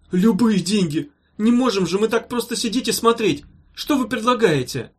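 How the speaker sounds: high, urgent bargaining; panicked patient imploring the doctor; desperate pleading. A narrator's reading. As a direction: beg frantically for any cure. Desperate patient begs for help.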